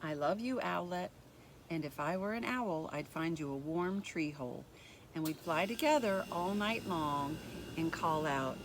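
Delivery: gentle, even storybook reading; reading to children. A narrator's reading. Teacher reading a picture book to kids, gentle storybook voice.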